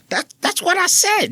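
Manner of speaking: high-pitched mock-indignant cry; indignant protest. Retelling his schoolboy protest to teacher, high and indignant.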